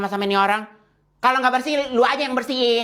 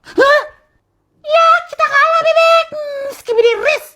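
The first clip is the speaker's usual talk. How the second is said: very high, loud squeaky cartoon voice; 9 dB louder than usual. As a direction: chirp it like a cartoon duck. Absurd squeaky character voice.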